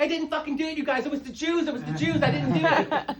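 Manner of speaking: loud, high frantic protest; panicked denial. Retold or imitated. Child with chalk blood yells at judging passers-by, high and loud. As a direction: shout it, desperate to clear yourself. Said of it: "I'm like".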